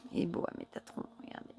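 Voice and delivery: hushed admiring whisper. Real, not acted. Showing viewers the Metatron card, in a whisper. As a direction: whisper as if sharing a secret.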